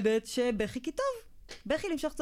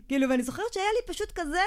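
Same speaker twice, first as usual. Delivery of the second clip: ordinary talk, nothing marked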